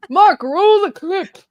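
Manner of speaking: put-on slow, high quavery British old-timer; old person voice. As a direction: play a creaky old British broadcaster asking for the clip. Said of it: "my old person voice was bad".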